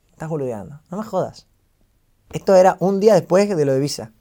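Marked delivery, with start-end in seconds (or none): 0.1-1.5 much softer, incredulous murmur, imitated; hushed disbelief. Friend refuses to believe the news, hushed disbelief.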